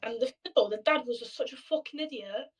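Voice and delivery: ordinary delivery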